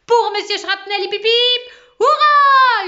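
high, roaring hip hip hurrah; yelled cheer. A narrator's reading. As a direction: lead the cheer at the top of your lungs. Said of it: "hurla Martin". (acclamation hurlée, très forte)

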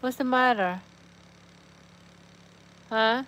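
ordinary talk

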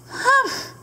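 squeaky high hump; a lazy, snooty grunt; high camel hump. Acted. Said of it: "said Camel".

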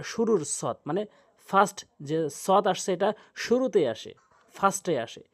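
normal talking voice

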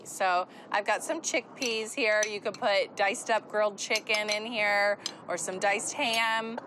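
ordinary talk

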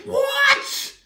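very high, loud shriek; outraged high-pitched what. Acted. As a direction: shriek in disbelief. Reader stunned.

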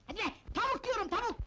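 high, rapid irritated outburst; exasperated yelling. Acted. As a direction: yell it as if she's hard of hearing. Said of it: "ne bağırıyorsun?". (sinirle bağırma)

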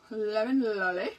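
"Lemon lolly" in a playful mock British accent. Shown as a demonstration. Crafter loves saying the pen name in a British accent. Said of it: "you have to say it with a British accent".

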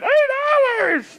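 put-on high, strained old-man yell; granddad's indignant shout. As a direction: snap it back as an outraged granddad. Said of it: "I feel like your granddad".

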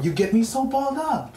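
high, loud, frazzled apology; flustered outburst. Acted. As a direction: blurt it out, all tangled up. Flustered boyfriend apologizing after insult.

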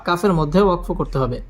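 ordinary delivery

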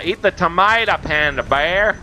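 "tomato" as tuh-MY-da; broad vowel and d sound in "tomato", ending in uh; corrected pronunciation. Shown as a demonstration. Coaxing the panda bear, "tomato" said tuh-MY-da. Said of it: "I should have said tomato".

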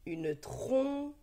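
"trompe" découpé, première syllabe longue et appuyée; détache bien le "pe" final de "trompe"; découpage en syllabes. Shown as a demonstration. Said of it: "Dis la première syllabe de chaque mot". ("trompe" syllabe par syllabe, lentement articulé)

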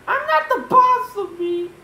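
high, loud character voice; George defiant. Acted. George rebelling against his own double, high and loud.

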